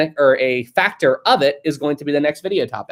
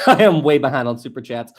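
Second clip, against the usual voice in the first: ordinary talk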